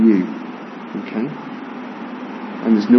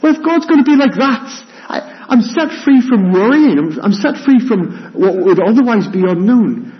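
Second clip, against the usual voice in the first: excited; 12 semitones higher than usual. Acted. Voicing the freed believer.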